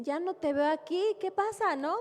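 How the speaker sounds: jealous husband. Retold or imitated. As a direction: imitate the suspicious husband. Esposo celoso reclama a la esposa. (imitando al esposo celoso, más agudo)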